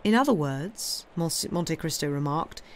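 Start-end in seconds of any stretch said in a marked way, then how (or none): none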